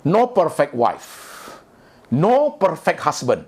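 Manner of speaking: No perfect wife, No perfect husband, each said slowly with weight on No; slow emphatic stress. Acted. Preacher hammering home his point to the congregation, slow and emphatic. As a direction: lay each word down slowly, leaning on No.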